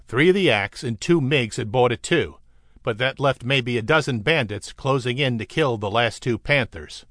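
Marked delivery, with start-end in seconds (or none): none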